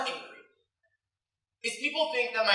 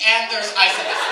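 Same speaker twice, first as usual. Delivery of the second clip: ordinary talk, nothing marked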